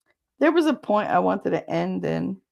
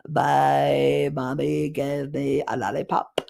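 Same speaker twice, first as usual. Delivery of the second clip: put-on childlike voice; slower than usual. Quoting a child.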